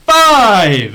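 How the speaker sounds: loud triumphant exclaim; excited. Real, not acted. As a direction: shout it out, sure now. Confirming loudly.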